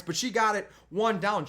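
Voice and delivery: ordinary talk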